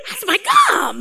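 high-pitched comic dismay; silly panicked outcry; mock inner protest. Inner protest on being called up.

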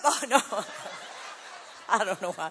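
laughing at own joke. Real, not acted. Jokes, then laughs at himself.

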